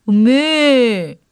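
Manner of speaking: long drawn-out imitated bleat; imitated goat cry. A narrator's reading. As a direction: bleat like a goat, slowly stretched. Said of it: "이렇게 울어야 할 염소였습니다".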